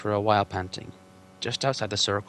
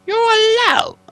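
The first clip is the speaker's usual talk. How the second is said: very high, loud stubborn troll; troll William defiant; 13 dB louder, slower than usual. Acted. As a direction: refuse like a sulky troll.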